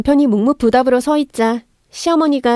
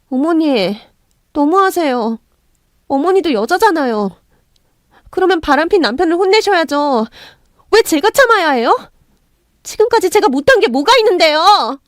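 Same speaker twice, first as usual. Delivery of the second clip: high, aggrieved and getting louder; defiant protest; 6 semitones higher, 3 dB louder than usual. A narrator's reading. Wronged wife talks back to mother-in-law, defiant. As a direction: push back, building to an indignant cry. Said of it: "하고 대들었습니다".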